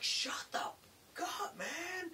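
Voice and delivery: high, strained imitation shout; yelling. Tim yelling at his singing coworker, mock furious shout. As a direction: yell it high, dragging out the words. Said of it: "Tim's just yelling at him".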